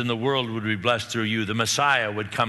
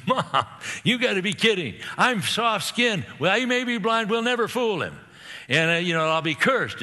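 put-on much higher, laughing young Jacob protesting; anxious Jacob; 9 semitones higher than usual. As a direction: play Jacob half-laughing, alarmed at the plan. Jacob protests mother's plan, laughing.

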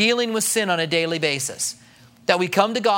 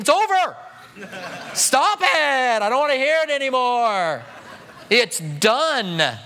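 high, laughing, mock-impatient God; exasperated God; 5 semitones higher, slower than usual. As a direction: wave off the repeated apology with a laugh. God voiced dismissing confession, laughing.

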